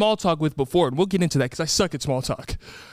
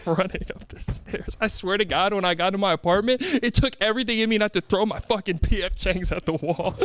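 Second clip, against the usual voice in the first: ordinary delivery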